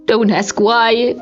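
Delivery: ordinary delivery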